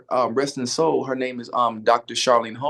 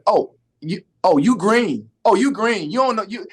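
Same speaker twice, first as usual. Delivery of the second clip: teasing realization; 7 semitones higher, 4 dB louder than usual. Retold or imitated. Acted lady spotting a novice.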